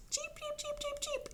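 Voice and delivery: tiny, soft, very high cheeps; rapid little peeps like baby chicks; cheeping chicks. Pretend. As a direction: squeak like a small chick. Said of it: "The little chicks cheeped".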